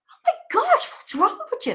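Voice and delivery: high, exasperated inner cry. Retold or imitated. Reacting to her mother's question, high and exasperated. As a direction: throw up your hands in mock disbelief. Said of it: "And it's like".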